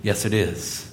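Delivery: a soft, deadpan yes it is; quiet wry agreement. Real, not acted. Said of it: "To which I say".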